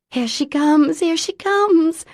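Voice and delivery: high, loud eager announcement; excited announcing. A narrator's reading. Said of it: "announced a woman".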